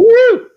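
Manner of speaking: very high whooping woo; a loud rock-and-roll cheer. Real, not acted. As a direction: let out a big sign-off whoop. Guest signs off cheering.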